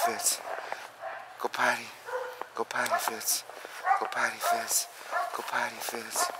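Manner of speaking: soft, even repeated cue; quiet coaxing. Real, not acted. Trainer coaxing dog to go potty, very soft and even. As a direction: murmur the command patiently.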